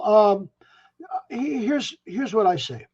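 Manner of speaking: ordinary delivery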